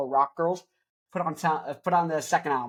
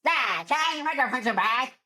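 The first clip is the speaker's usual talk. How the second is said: higher put-on old lady voice; scrappy grandma, higher; imitating grandma; 7 semitones higher than usual. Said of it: "That's what she sounds like".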